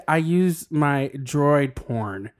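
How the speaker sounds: reading it out very slowly, word by word; slow misreading. Retold or imitated. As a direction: drag each word as if reading a strange title. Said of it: "I said, "I thought it read".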